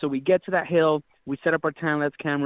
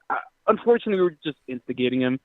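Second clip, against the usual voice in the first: ordinary delivery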